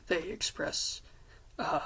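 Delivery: ordinary delivery, nothing marked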